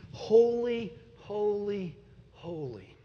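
high, drawn-out start fading to a hush; solemn echo of a holy cry; reverent refrain. Retold or imitated.

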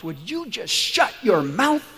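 loud, high, drawn-out outburst; a fed-up husband's mock yell, word by word; acted angry shout. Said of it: "and he shouts".

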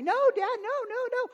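put-on 3 whine it like a kid caught out; 1 whiny kids protesting. Kids arguing back with dad.

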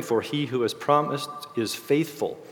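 ordinary delivery

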